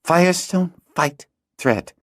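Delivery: put-on high girl's voice, slow and musing; a girl puzzles out his written words; thinking aloud. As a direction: piece the words together. Hala slowly puzzles words.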